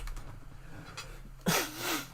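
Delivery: breathy cough. Real, not acted. Sneeze coming, coughs.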